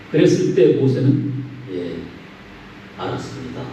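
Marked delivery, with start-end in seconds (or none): 1.7-3.6 meek whispered reply. Retold or imitated. Meek Moses answers God.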